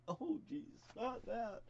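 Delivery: high, whiny oh jeez; a mock-groaning reaction; lightly voiced dismayed listener.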